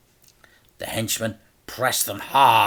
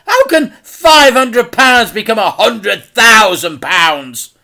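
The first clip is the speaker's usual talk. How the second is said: put-on higher, louder indignant boy; 12 semitones higher, 13 dB louder than usual. A narrator's reading. Frank protests the debt, indignant. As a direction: demand an answer.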